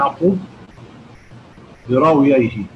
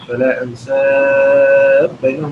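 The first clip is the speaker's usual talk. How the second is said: أنساب chanted with the longest held vowel; slow recitation, full-length stretch on أنساب; full madd; 3 dB louder, slower than usual. Shown as a demonstration. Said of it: "بالإشباع".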